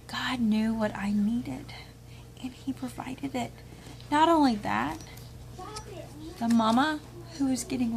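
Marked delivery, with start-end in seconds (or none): 0.1-3.5 whisper. Real, not acted. Grateful hushed aside about God.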